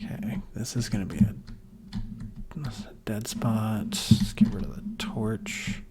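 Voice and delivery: quiet whispered building commentary; soft whisper. Acted. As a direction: whisper along as you place blocks. Whispers while building in game.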